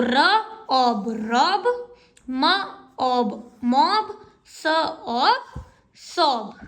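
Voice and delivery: slow blending of "र" and "ऑब" into "रॉब"; sounding out words. Shown as a demonstration. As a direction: draw out each part, then say "रॉब" whole. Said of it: "ओ की sound ओ". (धीरे-धीरे ध्वनि जोड़कर "रॉब" पढ़ना)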